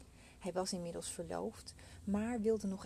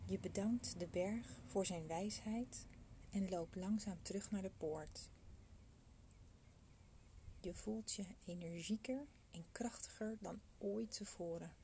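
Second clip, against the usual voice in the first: soft spa whisper; 3 dB quieter than usual. Acted. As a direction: guide them gently into calm. Leads a meditation.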